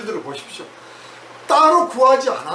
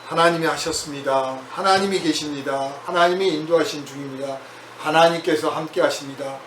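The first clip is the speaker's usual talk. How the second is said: 차분한 고백; 7 semitones lower, 3 dB quieter than usual. Shown as a demonstration. 고백하는 입버릇 예시.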